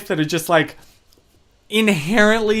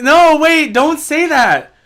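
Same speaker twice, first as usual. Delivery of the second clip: higher, louder flustered protest; 8 semitones higher, 6 dB louder, slower than usual. Real, not acted. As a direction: wave off the compliment, flustered. Protesting a compliment to chat.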